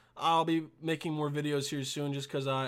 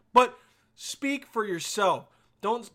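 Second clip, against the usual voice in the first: ordinary talk, nothing marked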